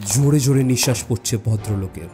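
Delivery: ordinary delivery, nothing marked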